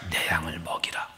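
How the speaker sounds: low whispered command. Acted. Jesus charging Peter to feed his sheep, low whisper.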